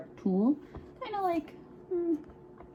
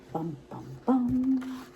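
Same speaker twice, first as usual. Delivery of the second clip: dramatic bum bum bum; 5 semitones higher, 4 dB quieter, much slower than usual. Acted. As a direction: build suspense with each bum. Dramatic suspense sound.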